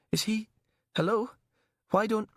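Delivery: high, tense and frightened; calling out nervously. Acted. Panicking prisoner.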